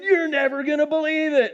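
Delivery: high-pitched imitation of excited news; retold excitement. Doctor phoning back weeks later, imitated excitement. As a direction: burst out with a mock breathless discovery.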